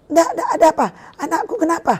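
panicked mother pleading for news; retold screaming. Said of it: "Saya udah teriak-teriak begitu".

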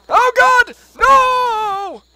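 very high, loud screams; panicked scream. Real, not acted. Player panicking at danger in game, panicked scream. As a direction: scream out in alarm.

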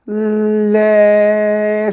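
L held long, gliding slowly into its vowel; stretched syllable. Shown as a demonstration. Teacher sounding out the next syllable for beginners, stretched. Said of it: "Diğer renkli heceye bakalım".

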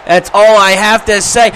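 loud, forceful repeat; loud, insistent declaration; emphatic. Real, not acted. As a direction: hammer every word home loudly.

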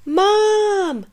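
put-on high, loud, drawn-out kid yell for mom; little monster yelling for his mom. A narrator's reading. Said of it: "Albert yelled".

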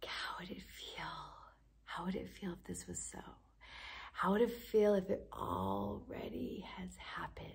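hushed, airy meditation whisper; guided whisper. Acted. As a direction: softly invite them to imagine.

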